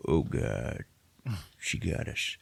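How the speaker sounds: mock hushed defeat; defeated mutter. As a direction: concede under your breath. Council conceding to Jyn.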